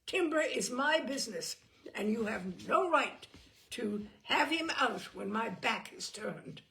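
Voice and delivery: loud furious outburst. A narrator's reading. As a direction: hold the stable in a rage. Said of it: "he said, furious".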